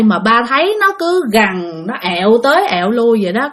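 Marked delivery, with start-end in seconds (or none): none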